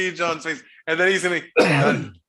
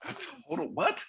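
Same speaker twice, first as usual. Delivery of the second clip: high, soft incredulous question; can't believe what he just heard; disbelief; 10 dB quieter than usual. Real, not acted. Cohost reacting to the insult with quiet disbelief. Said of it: "reacting in disbelief".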